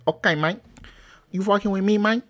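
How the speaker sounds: high, loud mock Cuban accent; Al Pacino's Cuban accent. Mocking Scarface for his co-host, hammed-up Cuban accent. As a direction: put on an exaggerated movie-Cuban accent. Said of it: "Al Pacino with the accent".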